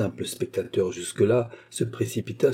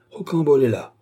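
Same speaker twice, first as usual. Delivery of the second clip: higher, louder boast; cocky reassurance; 4 semitones higher, 5 dB louder than usual. A narrator's reading. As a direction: announce yourself boldly to calm her. Boy boasts to reassure his mother.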